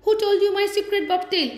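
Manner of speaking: high, quick accusing question; cross demand. A narrator's reading. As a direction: demand an answer indignantly. Cross squirrel confronts Bobtail.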